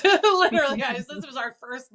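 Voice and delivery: a real burst of laughter on the word; laughing, loud and high. Real, not acted. Laughing at her own story.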